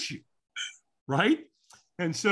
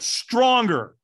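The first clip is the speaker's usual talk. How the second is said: loud, higher urging; imitated coach's raised command; trainer's demand; 7 semitones higher, 6 dB louder than usual. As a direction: push the trainee for more. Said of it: "he went".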